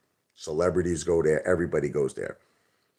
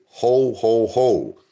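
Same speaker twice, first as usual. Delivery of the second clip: louder, higher mock Santa laugh; imitating Rocky's correction; 5 semitones higher, 6 dB louder, much slower than usual. Rocky tells Santa the right line, hammed-up. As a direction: bellow it like a proper Santa. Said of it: "I think you're supposed to say".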